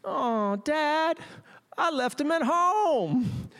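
put-on high, slow boy's voice, dragging it out; a kid sheepishly owning up to his dad; slow, high-pitched guilty son; son's confession. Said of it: "And he goes".

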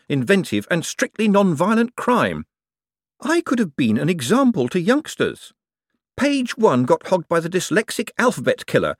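affronted swindler airing a grievance; aggrieved conman. Acted. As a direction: protest with wounded professional pride. Moist complains about his obituary.

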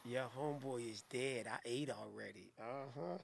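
low, soft, flat-out defeated; deadpan. Real, not acted. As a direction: drop to a spent murmur. Deadpan after laughing fit.

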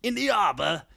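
mock Cockney accent. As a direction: join in with a hammed-up Cockney. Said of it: "like, a Cockney accent".

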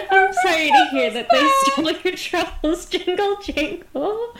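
laughing sympathy. Real, not acted. Consoling goblin, laughing.

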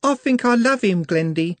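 put-on high, girlish voice, lovestruck; high girlish voice, dreamy; dreamy young girl. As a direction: sound like a smitten young shopgirl. Juliet's voice.

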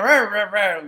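loud, high, wordless mock talk; garbled farmer impression. Co-host mimics the farmer's unintelligible talk, mocking garble. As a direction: imitate someone talking in gibberish. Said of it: "He sounds like that".

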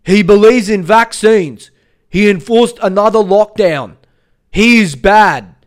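loud, high-pitched ranting impression; Harvey Yemeni. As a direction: shout each accusation in a put-on voice. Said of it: "He talks like this".

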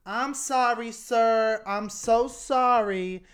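loud, high hammed-up apologizing; mock apology. Storyteller as driver apologizing after the fender bender, mock pleading. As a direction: plead loudly, drawing out the last sorry. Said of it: "And [name] goes".

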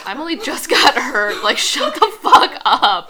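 loud, rising indignation; exasperated outburst. Real, not acted. Annoyed at a disrespectful line.

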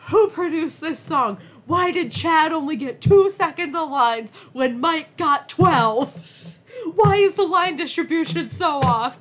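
loud high fan rant; hammed-up fan fury; mock outrage.

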